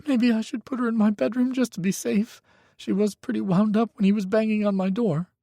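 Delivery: put-on high, tense young voice; a frightened young man pleading on the phone; young man, scared. A narrator's reading. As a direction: Micah telling Hawk his ex is dangerous, high and scared. Micah's voice.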